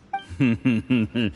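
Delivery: a short, courteous laugh; grateful little chuckle; polite laugh. Acted. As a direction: laugh politely to please him.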